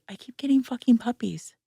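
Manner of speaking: hushed, rueful whisper; whispered confession. Real, not acted. Admitting to her co-host why she keeps adopting dogs, whispered. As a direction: let it slip out under your breath. Confessional aside about coping.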